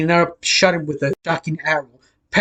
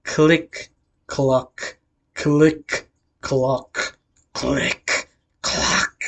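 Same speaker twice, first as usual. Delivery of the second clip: slow, evenly spaced click-clock; storyteller voicing mechanical clicks and clocks; imitated clicking sound; 3 semitones lower, much slower than usual. A narrator's reading. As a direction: tick out each word with gaps between. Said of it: "you just hear a sound going".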